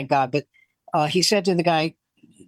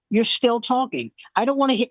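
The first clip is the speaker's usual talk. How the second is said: higher-pitched mock scolding; nagging wife; 4 semitones higher than usual. Wife to apologising husband, relentless nagging. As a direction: refuse to let it go, as the wife. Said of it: "She wouldn't let it go".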